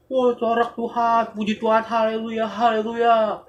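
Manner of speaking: high, loud hammed-up hallelujahs; loud mock worship. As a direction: overdo the Sunday praise. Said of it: "nyanyinya paling kenceng". (pujian lebay yang tinggi dan keras)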